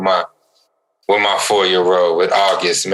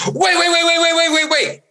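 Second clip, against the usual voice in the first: put-on high frantic child shouting wait; child's high panicked cries; panicked kid yelling. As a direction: shriek it like a scared four-year-old. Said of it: "all of a sudden he goes".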